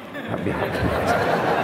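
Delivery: put-on whispered prayer into a child's ear; father whispering the prayer. Shown as a demonstration.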